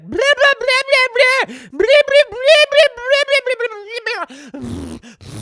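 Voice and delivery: put-on high, loud, squawking Florida accent parody; mock Florida accent. Mocking how Florida sounds, in a loud, high Florida accent. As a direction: ham up a Florida accent in nonsense syllables, then slow it down. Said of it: "the Florida accent is obviously like".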